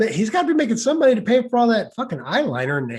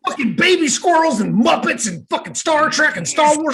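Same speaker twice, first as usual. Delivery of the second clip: mock rant; 3 semitones higher than usual. Skit rant.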